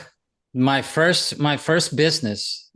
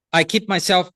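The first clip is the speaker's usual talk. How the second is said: normal talking voice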